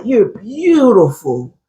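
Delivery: slow, drawn-out radio-voice compliment; Steve. Retold or imitated. Retelling Steve wooing his date, slow radio voice. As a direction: imitate a smooth baritone, stretching the words. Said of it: "a radio voice".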